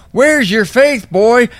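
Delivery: high, sneering mock rebuke; mock scolding churchgoer. Mocking churchgoers scolding John the Baptist, high sneer. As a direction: sneer it down at him. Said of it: "These people would have looked down".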